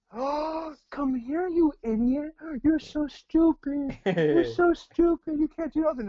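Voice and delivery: put-on high, slow cooing baby talk; insults cooed like sweet nothings; sweet tone, mean words. Dog owner coos insults at his happy dog, high baby talk. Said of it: "positive things in a negative tone".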